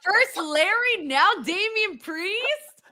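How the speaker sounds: high, very drawn-out mock outrage; mock shock. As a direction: stretch each name in playful disbelief. Teasing him as savage.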